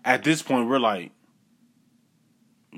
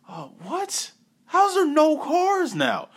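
surprised; slower than usual. Retold or imitated. Retelling surprise at empty street.

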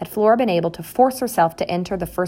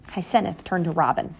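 ordinary delivery, nothing marked

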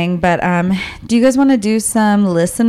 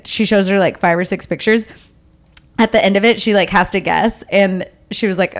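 ordinary talk

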